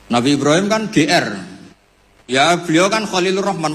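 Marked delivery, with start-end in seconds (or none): none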